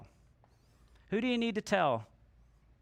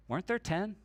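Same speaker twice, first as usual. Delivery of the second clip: quiet Jesus voice; 5 dB quieter than usual. Retold or imitated. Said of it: "And Jesus says".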